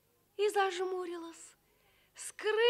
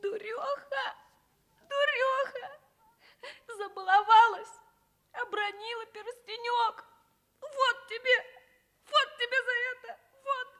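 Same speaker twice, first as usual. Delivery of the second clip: high, tearful self-scolding with a cry; a girl scolding herself while crying; 5 semitones higher than usual. Acted. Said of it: "и бранила себя".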